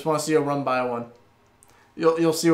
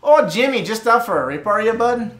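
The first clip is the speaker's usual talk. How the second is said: higher-pitched mock Canadian accent; hammed-up chummy Canadian, pitched up; 5 semitones higher, 4 dB louder than usual. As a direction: call to a pal as a cartoon Canadian. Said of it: "gonna be Ca-, Canadian now".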